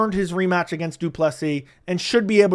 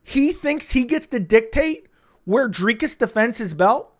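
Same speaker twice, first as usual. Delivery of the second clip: usual speaking voice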